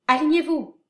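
high, loud battle orders; shouting commands. Acted. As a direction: bark orders to the troops. Officer commanding troops.

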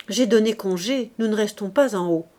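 ordinary delivery

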